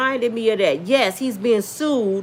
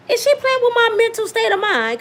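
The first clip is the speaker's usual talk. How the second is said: incredulous; 9 semitones higher than usual. Retold or imitated. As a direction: ask it in shocked disbelief. Incredulous inner reaction.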